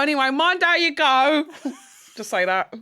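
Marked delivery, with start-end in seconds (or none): 0.0-1.4 high, loud chirpy Essex farewell; signing off a chat in a chirpy Essex voice; Essex goodbye. Retold or imitated. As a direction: sign off in cheery Essex. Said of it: "And you go, like".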